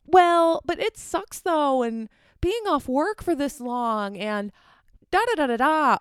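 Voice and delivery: high, loud whiny mock complaint; hammed-up whining, drawn out; mocking whiner. Mocking people who complain about being off work. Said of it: "I am so not sympathetic toward the".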